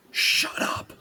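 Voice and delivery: whispered shush; hushed whisper. Retold or imitated. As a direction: hiss it under your breath. Quoted hushing in story.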